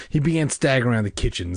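ordinary delivery, nothing marked

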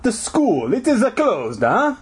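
high, hammed-up Italian janitor; bad Italian accent. A narrator's reading. Janitor warns two kids off, mock Italian accent. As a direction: put on a cartoon Italian accent. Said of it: "janitor growled like a wop".

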